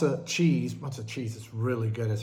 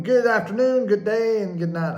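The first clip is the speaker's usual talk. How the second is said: high, loud Truman-style farewell; Jim Carrey impression; 8 semitones higher, 8 dB louder than usual. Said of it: "What was it Jim Carrey said?".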